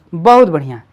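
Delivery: usual speaking voice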